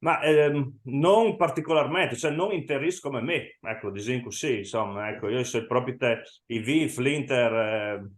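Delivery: ordinary talk, nothing marked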